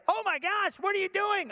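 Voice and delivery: high-pitched mock alarm; horrified wife. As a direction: imitate a wife shocked at what she has walked in on. Acts wife finding the fire.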